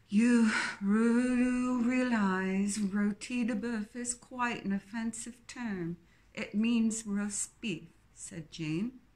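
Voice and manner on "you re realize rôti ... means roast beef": lightly voiced Queen-like snob, slow at the end; a hint of the Queen's English, snooty; snobbish posh English. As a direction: correct her with exaggerated snobbery. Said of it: "reminiscent of the Queen ... exaggerated snobbery".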